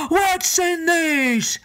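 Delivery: slow, pained giant roar. Acted. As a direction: roar over broken teeth. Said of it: "he roared".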